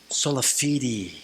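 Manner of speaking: sola FEE-dee, slow and low; careful teaching voice; sola fide. Shown as a demonstration. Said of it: "Say fide".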